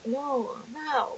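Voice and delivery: a bashful, hushed refusal; quiet. Retold or imitated. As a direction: murmur it quietly. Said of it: "he just kinda got quiet".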